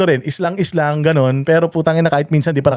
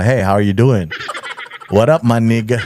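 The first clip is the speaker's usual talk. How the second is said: put-on American rapper; 5 semitones lower than usual. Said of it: "para kang rapper".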